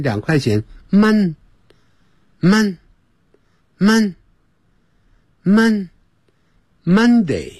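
Mon- said like Cantonese 蚊, slowly repeated; slow drawn-out Mon. Shown as a demonstration. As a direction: drag out Mon-，Mon- then land Monday. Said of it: "广东话一蚊". (像广东话“蚊”一样慢念Mon)

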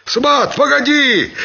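high-pitched shout to a crony; call from next table. A narrator's reading. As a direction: holler to hold on. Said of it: "доносилось с соседнего стола". (крик с соседнего стола)

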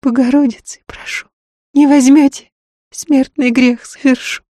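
high-pitched, desperate woman's plea; imploring. A narrator's reading. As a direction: beg for rescue, distraught. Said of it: "умоляюще схватила за руку".

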